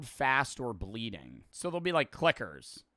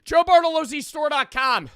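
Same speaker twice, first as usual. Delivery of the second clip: loud, high announcer-like yell; shouted plug; 13 semitones higher, 10 dB louder than usual. Real, not acted. Streamer plugs his own merch store, shouted plug. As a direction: belt out the web address.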